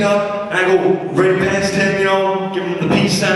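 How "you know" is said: usual speaking voice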